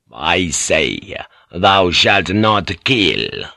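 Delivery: very slow, weighty commandment; solemn insistence. Acted. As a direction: insist slowly, stretching each word. Priest repeats the commandment firmly.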